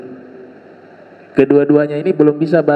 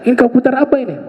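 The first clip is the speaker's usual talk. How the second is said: scolding teacher; 8 semitones higher, much faster than usual. Retold or imitated. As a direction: demand an answer like a stern teacher. (guru menegur dengan marah)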